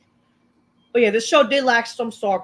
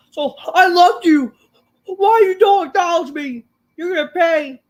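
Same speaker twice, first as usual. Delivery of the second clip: higher, louder mock-angry voice; menacing threat; 8 semitones higher, 7 dB louder than usual. Solo threatening Paul Heyman in a higher, louder mock-angry voice. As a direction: spit out the threat like a betrayed boss.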